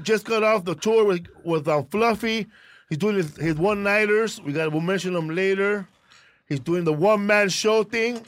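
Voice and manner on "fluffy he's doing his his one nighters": usual speaking voice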